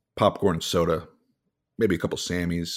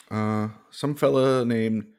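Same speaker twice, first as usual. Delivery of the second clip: ordinary delivery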